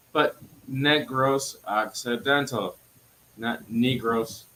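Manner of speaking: Negros said NEG-ros, not NEE-gros, slow and emphatic; slow firm correction of Negros; correcting pronunciation. Shown as a demonstration. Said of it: "Pronounce it fucking shit right".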